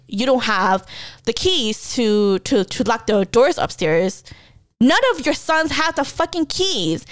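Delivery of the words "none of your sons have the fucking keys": higher-pitched, exasperated insistence; moderately heated, not shouted; retold pissed-off rant. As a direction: argue the point at dad, worked up. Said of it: "I got so pissed".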